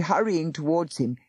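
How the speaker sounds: plain reading voice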